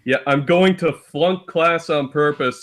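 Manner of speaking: ordinary talk, nothing marked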